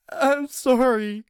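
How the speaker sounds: high, slow, hurting plea; pained and scared. Acted. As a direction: whimper it out in pain. Anthony cries out in pain.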